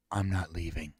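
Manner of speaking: low, soft deadpan refusal; imitated stubborn sailor. Cohost plays that one guy, low and quiet. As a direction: drop low and quiet for the holdout. Acting out the sailor who stays.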